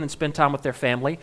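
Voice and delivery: ordinary delivery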